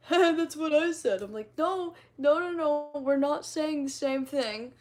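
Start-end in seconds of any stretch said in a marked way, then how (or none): 0.1-1.2 loud, higher, drawn-out comeback; mock-indignant retort; retold playful protest. Acting out joking argument. 1.6-4.7 loud, higher mock protest; hammed-up argumentative 'no'; retold bickering. Acting out joking argument.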